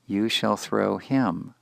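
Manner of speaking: slow, weighted "You shall throw him" with a falling "him"; teacher spelling out a literal translation; slow literal reading. Shown as a demonstration. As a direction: read it slowly like a word-for-word gloss. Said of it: "literally means".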